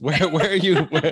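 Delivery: laughing through a high, loud question. Real, not acted. Host teasing guest about her accent, laughing. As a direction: giggle out the question.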